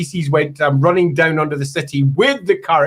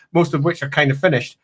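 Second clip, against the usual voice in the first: usual speaking voice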